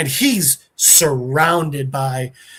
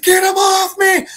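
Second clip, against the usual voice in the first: high, loud mock-panicked yell; freaking out; 5 dB louder than usual. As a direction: imitate someone yelling in panic. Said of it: "Dan's freaking out".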